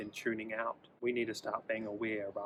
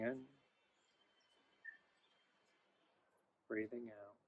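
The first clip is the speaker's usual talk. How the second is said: guided meditation. Acted. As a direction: ease the listener into stillness, gently.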